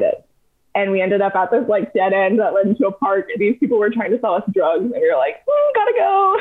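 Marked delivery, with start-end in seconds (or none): 5.4-6.4 high, squeaky little excuse; awkwardly edge away in a high voice; comic high-pitched bail-out; mock nervous exit. Acted nervous exit from drug sellers.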